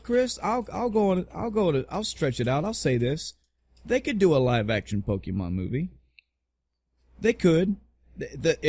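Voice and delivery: ordinary delivery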